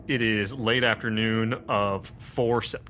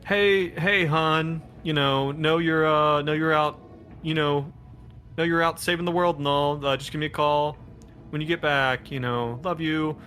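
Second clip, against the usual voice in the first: woman's voice; 5 semitones higher than usual. Retold or imitated. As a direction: leave a light, loving message for your man. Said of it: "are a woman's voice".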